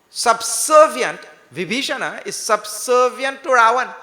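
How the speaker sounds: loud, high fervent sermon; preaching. Acted. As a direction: preach it with rising fervor. Preaching at full force.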